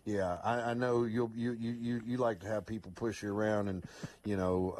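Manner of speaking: ordinary talk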